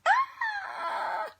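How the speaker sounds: sudden high cry of pain from a sore shoulder; pain yelp. Real, not acted. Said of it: "my shoulder has been hurting me".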